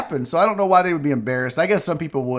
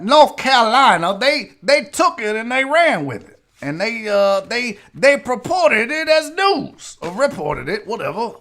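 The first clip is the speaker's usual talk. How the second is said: put-on Southern accent; 3 semitones higher than usual. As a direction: play it up as a Southerner. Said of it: "I don't know why I went Southern accent on that".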